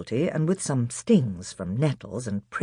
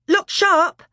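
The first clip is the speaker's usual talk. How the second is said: high loud urgent shout; 7 dB louder than usual. Acted. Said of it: "shouted Edmund".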